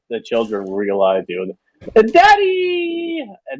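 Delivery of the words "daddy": high excited shout of Daddy; children's cry. Acted. Children spot their father and shout, high and excited. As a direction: kids shrieking for their dad.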